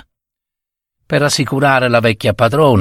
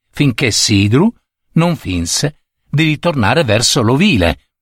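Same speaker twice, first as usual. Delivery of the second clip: plain reading voice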